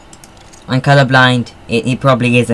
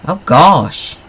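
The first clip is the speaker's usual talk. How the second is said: surprised exclaim; 5 semitones higher, 5 dB louder than usual. Real, not acted. Engine fails in game.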